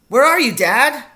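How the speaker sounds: high, loud questioning call. A narrator's reading. Boy searches for his unseen father, calling out. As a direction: call out, unable to see them.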